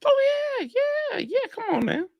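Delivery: very high, soft protest; squeaky-high comeback to a doubter; high-pitched protest. Real, not acted. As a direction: pitch it way up, keep it light. Scoffing back at rival's doubt.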